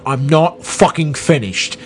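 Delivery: slow, imitated Cockney outburst; mock argument in a London accent; Michael Caine yelling. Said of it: "are yelling at each other".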